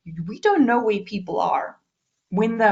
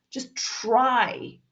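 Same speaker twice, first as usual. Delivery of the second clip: normal talking voice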